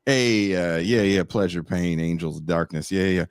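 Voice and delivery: lazy low voice, like a chill stoner; a low, easygoing demon voice; mock laid-back Hellraiser.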